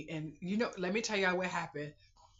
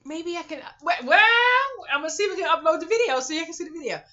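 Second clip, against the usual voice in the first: excited rush; 12 semitones higher, 13 dB louder, much faster than usual. Real, not acted. Excited idea.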